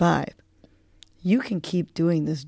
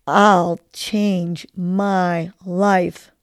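very slow, emphatic affirmation; deliberate mantra said with conviction; like you mean it; 3 dB louder, much slower than usual. Shown as a demonstration. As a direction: land each word firmly, drawn out. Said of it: "say it like you mean it".

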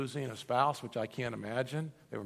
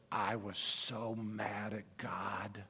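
slow, near-whispered admission of anger; retold hushed confession; 6 semitones lower, 8 dB quieter, much slower than usual. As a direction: whisper her confession, drawing it out.

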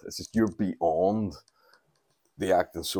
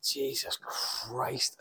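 slow, higher, softer disbelief; mock dismay; 5 semitones higher, 9 dB quieter, much slower than usual. Traveller seeing his countrymen rush the gate, appalled. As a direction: draw it out, appalled under your breath.

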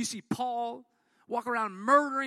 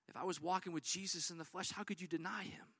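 quiet scoff; 4 semitones lower, 10 dB quieter, faster than usual. Acted. Congregant's boast, quietly voiced.

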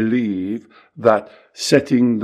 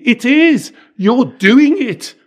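louder, higher, slow and firm on each word; emphatic insistence; 13 semitones higher, 6 dB louder, slower than usual. Real, not acted. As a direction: lay it down slowly, no arguing. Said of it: "insists emphatically".

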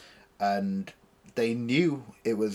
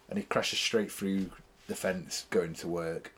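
ordinary talk

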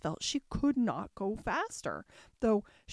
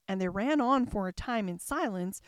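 ordinary delivery

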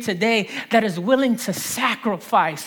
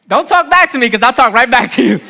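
louder, teasing fake scolding; playful mock scolding; 9 dB louder, faster than usual. Pastor teasing Cassidy from the stage with a loud playful rebuke. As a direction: pretend to tell off a friend who talked back.

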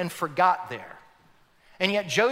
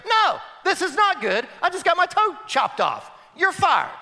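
mock angry king, higher and louder; 12 semitones higher, 6 dB louder than usual. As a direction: play the furious king firing him. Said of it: "the king looks at him and goes".

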